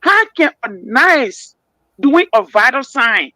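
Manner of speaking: ordinary delivery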